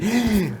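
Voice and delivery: a sharp, soft intake of breath in mock alarm; mock shocked gasp. Preacher mimics readers shocked by curses, soft gasp. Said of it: "이걸 보고 놀래요, 사람들이".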